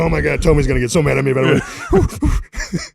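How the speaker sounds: a grown man playing a scared freshman; mock panic. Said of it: "He's like".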